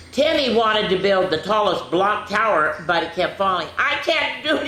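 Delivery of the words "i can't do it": high, upset wail of giving up; frustrated cry. Acted. Timmy's tower keeps falling, he cries out high and upset. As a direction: whine it like a child about to quit. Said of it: "he cried".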